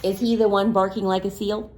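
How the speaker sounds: ordinary delivery, nothing marked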